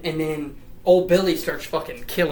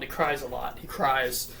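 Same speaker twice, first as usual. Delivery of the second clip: ordinary delivery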